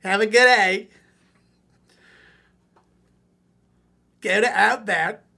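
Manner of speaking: mock Australian accent. Australian viewer mocking the coach in an Aussie accent. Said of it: "the accent sucks".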